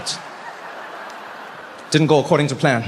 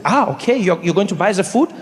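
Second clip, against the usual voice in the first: put-on German-accented friend, questioning; 3 semitones higher than usual. Friend asking who will pay, German accent. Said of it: "He's like".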